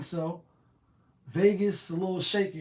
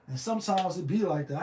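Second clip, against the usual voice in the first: ordinary talk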